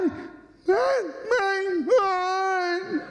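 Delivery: high, crying nasal wail; mock nasal sobbing. Nasal girlfriend crying and wailing at the slaps. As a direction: wail it like she is already in tears. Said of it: "já a chorar".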